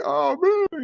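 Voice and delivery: high-pitched comic whine; exaggerated high wail of defeat; mock dismay. Acting out the son's dismay.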